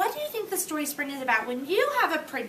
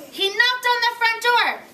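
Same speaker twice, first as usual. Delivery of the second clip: put-on reading to children; 10 semitones higher, 8 dB louder than usual. A narrator's reading. Teacher reading Frog and Toad to the class, storytelling voice. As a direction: read it out big for the little ones.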